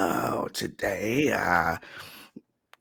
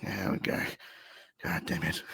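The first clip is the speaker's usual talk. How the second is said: resigned grumble; 8 dB quieter, faster than usual. Acted. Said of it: "was just like".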